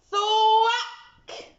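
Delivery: high, sharp sound effect; thwack sound. Acted. The axe hits the beanstalk, sharply. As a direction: smack it out like an axe blow.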